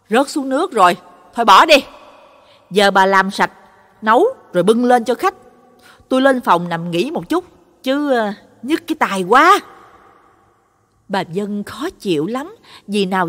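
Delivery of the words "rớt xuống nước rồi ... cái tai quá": irritated loud. A narrator's reading. Husband brushing off wife, irritated.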